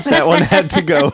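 laughing. Real, not acted. Laughing about puking on shirt.

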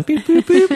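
high bright beep noises; robotic beeping. Retold or imitated. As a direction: chirp it like a little machine. Imitating antenna signals.

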